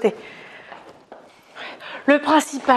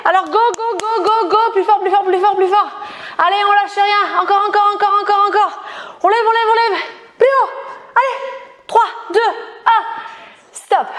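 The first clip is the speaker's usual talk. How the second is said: gym bro; 6 semitones higher than usual. Acted. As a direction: hype them through the last reps. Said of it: "je suis là pour vous coacher". (coach surexcitée qui encourage)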